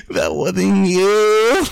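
a very slow, loud and high insistence; mock Tyson pleading, drawn out; slow emphatic Tyson. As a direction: stretch it out and insist. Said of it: "He goes".

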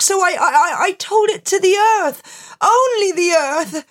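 high, stammering, anxious voice; nervous confession. Acted. Barber confessing in fear.

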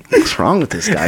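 high-pitched, laughing through the words; squeaky laughter breaking into speech; amused bewilderment. Real, not acted. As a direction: crack up while asking in disbelief. Laughing at the man's smiling.

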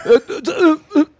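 high, loud stammering crowd; crowd stumbling. Retold or imitated. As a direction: fumble the scat like a lost crowd. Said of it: "And they're like".

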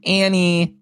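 ANNIE held long and pitched high; demonstrating a high, lingering "Annie"; drawn-out name inflection. Shown as a demonstration. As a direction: stretch "Annie" out, high and level. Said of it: "with that afflection".

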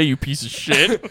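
hammed-up slow insult to the bank rep; mock parting insult. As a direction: spit it out slowly like a send-off insult.

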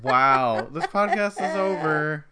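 loud, slow fake huff; mock outrage. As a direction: storm off in jest.

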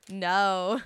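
sharp loud no. Real, not acted. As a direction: shut it down loudly. Reacting to the accent bit.

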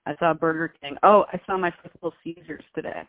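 ordinary talk, nothing marked

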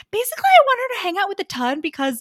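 high, fast, scheming mother voice; mock high-society mother; quoting a scheming mother. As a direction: rattle it off as the plotting mom. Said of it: "She's like".

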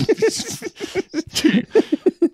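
high, squeaky fit of laughter; helpless laugh. Real, not acted. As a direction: crack up helplessly at the joke. Cracked up by samurai joke.

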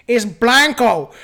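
loud, slow Texas drawl on "Blanco"; Texas accent. Shown as a demonstration. Host mocking Texans who say it right, thick Texas accent. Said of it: "you got a thick-ass accent".

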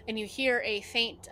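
ordinary talk, nothing marked

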